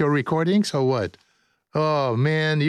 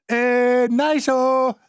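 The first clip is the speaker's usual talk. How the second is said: playful exclamation; 9 semitones higher, much slower than usual. Real, not acted.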